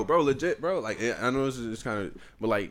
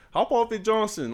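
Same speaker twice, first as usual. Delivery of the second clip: high, loud imitated jeer; mock call-out; 11 semitones higher, 4 dB louder than usual. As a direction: heckle in a raised, higher voice. Coworker's retold jeering call-out.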